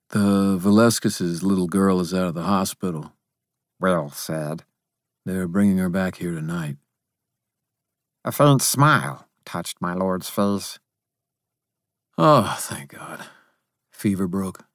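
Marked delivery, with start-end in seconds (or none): 0.1-6.8 lightly voiced steady young man; plain, practical report; a touch of a solid, calm guard; Will. 12.2-14.7 lightly voiced tired wizard, relieved; understated weary relief; relieved Harry.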